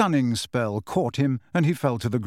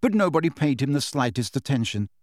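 usual speaking voice

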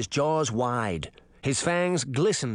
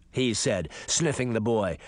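ordinary delivery

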